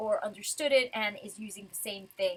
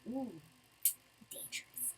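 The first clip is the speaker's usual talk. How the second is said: a hushed, unvoiced aside; mock whisper; 18 dB quieter than usual. Real, not acted. Mock awe at villain's boast.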